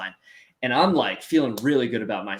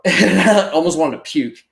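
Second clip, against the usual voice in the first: laughing while he admits he almost threw up; a real laugh, louder and higher; 6 semitones higher, 8 dB louder than usual. Real, not acted. Laughs at his own fear.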